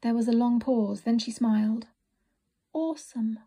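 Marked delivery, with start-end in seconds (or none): 2.7-3.4 sleepy mumble. A narrator's reading. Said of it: "mumbled Winifred sleepily".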